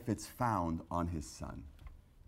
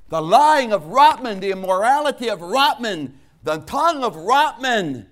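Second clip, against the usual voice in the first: high, loud shouted preaching, held; preaching at full force; 16 dB louder than usual. Acted. Preacher shouting Jesus' agony to the congregation, high and loud. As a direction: hammer it home at the top of your voice.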